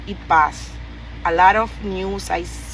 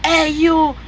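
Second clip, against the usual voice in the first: a higher, mock call of hey you; hint of a jeering driver; mocking taxi man; 7 semitones higher, 3 dB louder than usual. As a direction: lightly mimic the driver's taunt. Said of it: "that's the only thing y'all know how to say".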